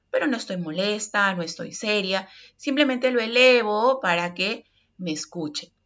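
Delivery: ordinary talk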